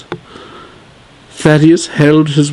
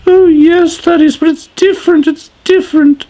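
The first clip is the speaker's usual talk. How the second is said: high wailing wife. Acted. Sadeas pleading with her husband, high and wailing. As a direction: wail it like a dramatic queen.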